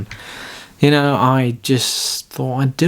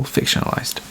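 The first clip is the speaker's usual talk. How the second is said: whisper; 5 dB quieter than usual. Real, not acted. Sly aside to listeners.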